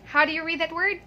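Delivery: usual speaking voice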